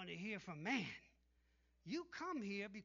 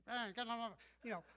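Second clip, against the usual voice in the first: lightly put-on Italian accent, a bit louder; a quick Italian-accented brush-off; 4 dB louder, much faster than usual. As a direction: toss it off like a breezy Italian. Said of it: "That's the Italian way".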